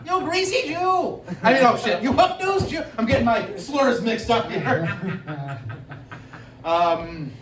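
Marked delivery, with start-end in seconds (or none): 0.1-2.8 angry restaurant owner. Pretend. Joke restaurant owner yelling at customer, high angry voice. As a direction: throw the customer out, pitched high and harsh.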